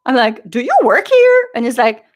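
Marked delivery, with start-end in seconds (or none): 0.5-1.5 very high, loud mock disbelief; incredulous challenge. Concertgoer challenging venue security, incredulous. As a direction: challenge them, voice leaping up. Retold exchange with clueless security guard.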